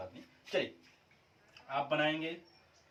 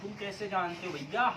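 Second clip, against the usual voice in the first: usual speaking voice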